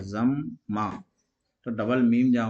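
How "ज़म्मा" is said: ज़म्मा slowly as "zam", then "maa"; split syllables. Shown as a demonstration. Teacher shows how it is read, ज़म्मा slowly split in two. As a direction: stretch ज़म्मा into two separate syllables, each m sounded. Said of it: "ऐसे पढ़ा है".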